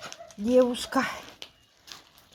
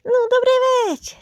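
higher, louder cheerful greeting; pet greeting; 10 semitones higher, 5 dB louder than usual. Real, not acted. Woman greets her waiting cat, higher and louder. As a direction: greet him like a dear guest.

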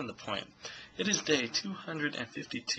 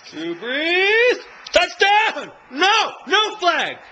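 much higher and louder shouts at the TV; real excited yelling; 8 semitones higher, 9 dB louder, slower than usual. Real, not acted. Fan yelling at the football game on TV, excited. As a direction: cheer and plead at the game.

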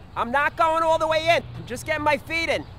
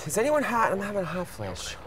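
ordinary talk

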